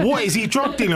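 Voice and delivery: high-pitched mock disbelief; incredulous. Student housemates eyeing his champagne, incredulous. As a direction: imitate scandalised onlookers, pitched right up.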